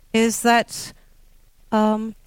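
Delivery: usual speaking voice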